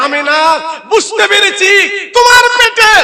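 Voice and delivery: very high, loud outburst; exclaimed revelation. Acted. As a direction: proclaim it at full voice, gradually coming down. Elder exclaims over the revelation.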